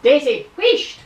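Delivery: sharp high call to hush a barking dog. Real, not acted. Owner calling the barking dog Daisy to hush, loud high call. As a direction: shout her down, high and loud.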